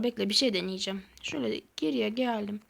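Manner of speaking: ordinary talk, nothing marked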